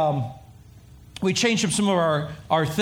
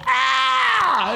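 high shouted cry of exasperation; hammed-up scream of despair; frustrated yell; 13 semitones higher, 3 dB louder than usual. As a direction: let out a mock yell of frustration. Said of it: "I was going".

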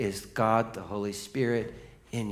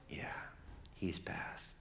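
very soft, low-key reply; hushed confirmation; 3 semitones lower, 17 dB quieter than usual. Retold or imitated. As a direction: break bad news very quietly. Chaplain quietly confirms a death.

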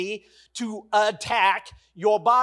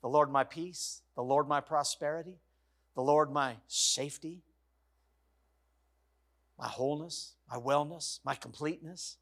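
soft confession; 7 semitones lower, 10 dB quieter than usual. Shown as a demonstration. Preacher showing the church how to confess God's names, soft. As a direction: lower and softer, a gentle prayerful listing. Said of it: "We can say it like this".

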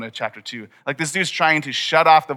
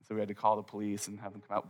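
usual speaking voice

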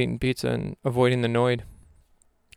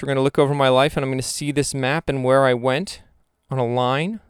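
usual speaking voice

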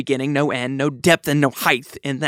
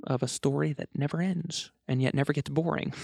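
ordinary delivery

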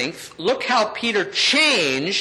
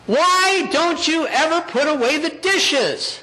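high-pitched, drawn-out mock nagging; exasperated mock complaint in a high voice; nagging wife; 10 semitones higher than usual. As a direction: imitate a wife scolding, pitched way up. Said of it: "Vicki says to me".

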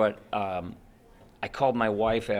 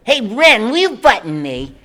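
high, loud goofy cartoon cat; squeaky dopey sidekick pleading; Stimpy voice; 11 semitones higher, 11 dB louder than usual. Stimpy asking Ren to button him, high and loud. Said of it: "he was like".

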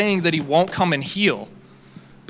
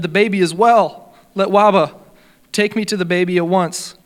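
mildly urgent, louder and higher; urgent exclamation; 4 semitones higher, 6 dB louder than usual. Acted. Visitor demands to be taken to the baby, urgent. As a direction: exclaim it with a lift in pitch and volume. Acted urgent reply in story.

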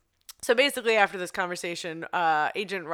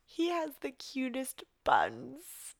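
slow, high flirty swoon; 7 semitones higher, much slower than usual. Retold or imitated. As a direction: savor it like a crush. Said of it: "the way she checks out his ass and then says".

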